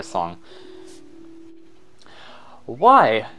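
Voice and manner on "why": loud, higher-pitched extravagant explorer; over-the-top gentleman explorer with a big personality; extra explorer voice. Acted. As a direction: declaim it like a self-important adventurer. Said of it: "give him an extra voice".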